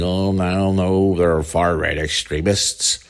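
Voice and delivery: slow, sneering mock of the media; lightly put-on voice, mocking the media. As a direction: drag it out like a smug pundit. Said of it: "mainstream media channels are saying".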